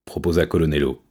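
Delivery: ordinary delivery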